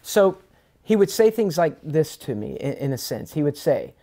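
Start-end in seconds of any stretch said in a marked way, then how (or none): none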